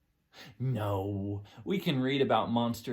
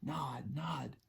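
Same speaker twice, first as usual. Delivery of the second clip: whispering; 9 dB quieter than usual. Acted. Sneaky whispered nods.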